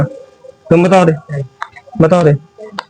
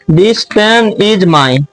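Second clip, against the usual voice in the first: slow dictation; much slower than usual. Shown as a demonstration. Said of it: "ध्यान से देखो".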